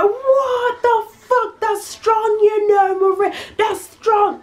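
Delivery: high, slow, strained wail of disbelief; shocked at the taste. Retold or imitated. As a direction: choke out the shock of a strong drink. Friend tasting strong vodka.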